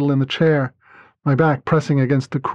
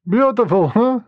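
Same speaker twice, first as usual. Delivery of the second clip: a mild amused lilt, rising high on huh; lightly voiced teasing laugh; a touch of a chuckle; 6 semitones higher than usual. A narrator's reading.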